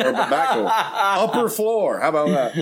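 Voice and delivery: high, giggling joke; real giggles; laughing. Real, not acted. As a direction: laugh through it, slow on upper floor. Joking about vomit exit.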